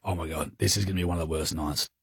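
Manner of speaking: quick, low, hushed dread; mock despair. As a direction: mutter it like parents bracing for hell. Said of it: "We're just going".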